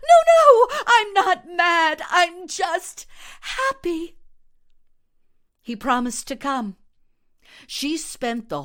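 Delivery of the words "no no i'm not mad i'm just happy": high, loud, half laughing half crying; giddy happiness spilling over into tears; laughing through tears. Acted. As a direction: Vakina on the phone to Yevgeny, laughing and crying. Vakina overjoyed on the phone.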